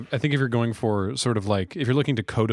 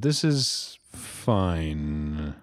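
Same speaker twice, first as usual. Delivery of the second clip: deadpan; 5 semitones lower, much slower than usual. Pretend. Ironic after bad cards.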